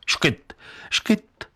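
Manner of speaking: high, breathy little hiccups; two quick mock hiccups; hiccupping. A narrator's reading. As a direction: imitate a girl's hiccups, light and airy. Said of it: "dělalo jí to pořád".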